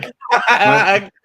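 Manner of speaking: high loud burst of laughter. Real, not acted. Laughing at clip.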